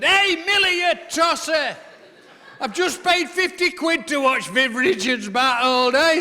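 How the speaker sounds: high, loud jeer from the back rows; drunk fan's shout. Retold or imitated. Spider-Man fan yelling at the bowler, loud jeering shout. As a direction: bellow it across the stand. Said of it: "stood up thirty rows back and shouted".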